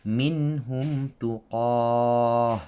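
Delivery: tuqah, ending on a breathy ha instead of a ta; Quranic recitation. Shown as a demonstration. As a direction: recite slowly, stopping on tuqah with a ha. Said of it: "So listen to how I read it".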